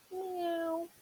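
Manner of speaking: a high little kitten meow; high cat meow. Acted. Teacher reading a dog's meow, high mew. Dog George says meow in story.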